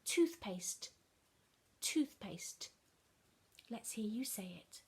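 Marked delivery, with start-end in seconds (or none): 3.7-4.8 whispered prompt; encouraging whisper. Acted. Teacher whispering a prompt to the children. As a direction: invite them in a whisper.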